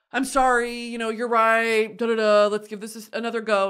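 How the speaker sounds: put-on apologetic boyfriend. Man with flowers asks for another chance, lightly pleading. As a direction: wheedle through a half-acted apology.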